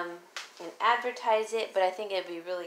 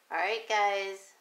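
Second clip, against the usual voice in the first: ordinary talk, nothing marked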